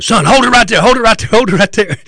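loud, high, mock-alarmed command; imitating an alarmed officer's urgent order; freaked-out cop. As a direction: shout it like a cop losing his nerve. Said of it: "now beginning to really freak out".

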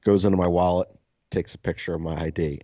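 ordinary talk, nothing marked

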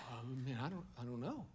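mock vague mumbled reply; clueless mumble. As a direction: mumble like someone with no reason. Aimless person answering shrug.

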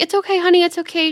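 lightly voiced kind stranger, soothing; sweet reassurance. Pregnant woman reassuring the narrator.

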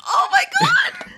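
high shriek of shock. Real, not acted. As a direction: scream it out in horror. Shocked at terrifying drawing.

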